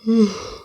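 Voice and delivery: long, high yawning sigh. Real, not acted. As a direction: let out a big yawn. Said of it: "Guess I'm still yawning".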